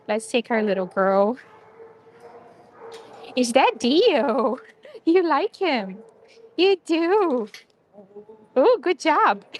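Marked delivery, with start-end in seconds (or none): 3.4-9.5 louder, higher praise for a dog; excited pet talk. Real, not acted. Visitor cheers dogs meeting, excited pet talk. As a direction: gush encouragingly, rising higher.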